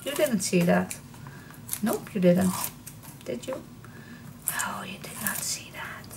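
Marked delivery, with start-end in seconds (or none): none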